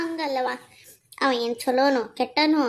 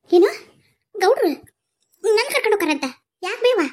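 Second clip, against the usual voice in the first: high, louder startled questions; raised, startled voice; shocked questioning; 8 semitones higher, 5 dB louder than usual. Acted. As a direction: react with alarmed disbelief. Daughter shocked at being fetched.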